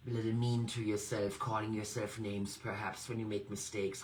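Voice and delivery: normal talking voice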